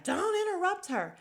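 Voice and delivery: loud, high-pitched imitated outburst; hammed-up high yell; mock outcry. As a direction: cut in like an outraged crowd. Retelling everybody's outburst at the table.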